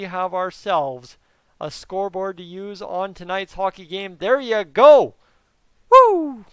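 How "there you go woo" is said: loud, high whoop of relief; triumphant cheer. Real, not acted. Streamer cheering the working scoreboard to viewers, loud whoop. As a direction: celebrate finally getting it working. Scoreboard finally works after setup.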